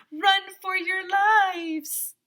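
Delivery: high, slow hammed-up cry of alarm; panicked kid. Kid warns the class about the bear, hammed-up high wail. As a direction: stretch it out like a cartoon panic.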